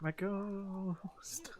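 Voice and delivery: slow, spooky ghostly whisper. Pretend. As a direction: breathe it out like a ghost. Pretends to be a ghost.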